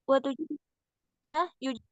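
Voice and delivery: choppy, breaking-up call audio; words cutting out on a weak signal; bad connection. Real, not acted. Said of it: "suaranya putus-putus".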